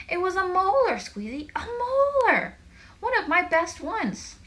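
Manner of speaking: very high, loud wail of protest; upset outcry. A narrator's reading. Lyle protests to Squeezy about his lost molar, upset. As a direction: stretch the key word into a high cry. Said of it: "how upset he was about it".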